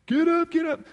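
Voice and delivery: high, loud drill instructor shout; wake-up yell. Retold or imitated. Instructors bursting into the barracks, loud yell. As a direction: yell them out of bed.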